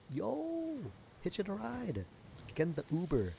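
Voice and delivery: thrilled to see the frog hitch a ride; squealing delight at the turtle taxi; excited. Real, not acted.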